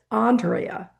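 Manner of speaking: Andrea as AHN-dree-uh; preferred name pronunciation. Shown as a demonstration. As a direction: open "ah" at the start of Andrea. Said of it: "there's so many variations".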